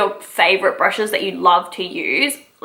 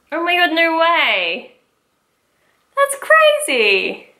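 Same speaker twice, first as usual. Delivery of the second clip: high, slow amazed exclaim; 7 semitones higher, slower than usual. Real, not acted. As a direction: gasp it out in disbelief. Amazed the brush is dry.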